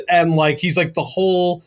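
ordinary delivery

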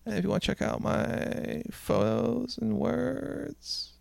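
high, quiet, drawn-out parody; faint squeaky mock plug; put-on voice. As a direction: drag the words out high and soft. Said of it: "don't do the voice".

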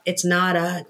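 normal talking voice

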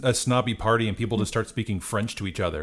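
usual speaking voice

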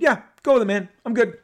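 high, loud, breezy go-ahead; Bob Harris. Retold or imitated. Acting out Bob's quick approval.

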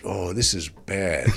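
slow, soft dread; sinking realization. Retold or imitated. Comic realizing on stage the crowd is lost, slow and hushed. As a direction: let the sinking feeling show, quietly.

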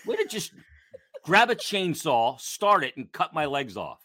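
high, laughing sarcastic jab; mock the rude cut-off, laughing; laughing sarcasm. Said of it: "I'm like".

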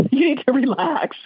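laughing while passing on the message; amused giggly delivery. Retold or imitated. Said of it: "she's laughing".

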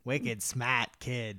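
put-on slow Boston accent, wicked smart. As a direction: drawl it like a Boston local.

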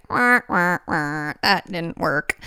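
mock whimpering baby voice, dropping low and slow; whiny ego. The ego whining as its grip loosens, mock wail. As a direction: pout it out like a sulking ego. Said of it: "Our ego has to be like".

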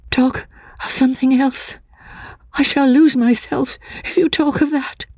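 high, frantic woman's voice; plead, teetering on losing herself; frightened woman. A narrator's reading. Frightened woman begging Walter to change the subject, high and desperate.

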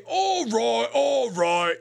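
exaggerated British soccer hooligan; Peaky Blinders accent. Host showing the accent his wife hates, hammed-up British. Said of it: "That's my Peaky Blinders accent".

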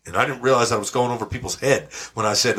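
ordinary delivery

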